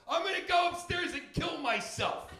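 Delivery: put-on high, loud, whining teen threat; bratty teen daughter. Comic imitating his daughter yelling mid-fight. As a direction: wail it like a dramatic teenage girl. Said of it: "my daughter goes".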